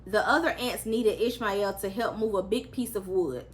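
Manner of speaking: plain reading voice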